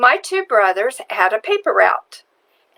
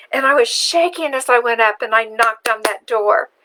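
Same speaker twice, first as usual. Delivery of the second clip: normal talking voice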